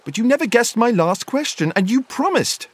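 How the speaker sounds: high-pitched, insistent character voice; Bilbo protesting. A narrator's reading. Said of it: "said Bilbo".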